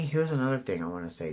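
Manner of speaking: ordinary talk, nothing marked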